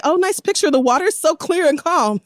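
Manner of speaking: high-pitched, innocently admiring reply; mock polite deflection. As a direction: imitate someone dodging a flirt with small talk. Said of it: "and you say".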